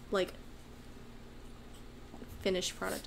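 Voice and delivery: ordinary talk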